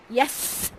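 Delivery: usual speaking voice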